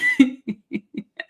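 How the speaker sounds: short jittery giggle; nervous laugh. Real, not acted. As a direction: laugh off the nerves. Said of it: "by the way, I have a nervous laugh".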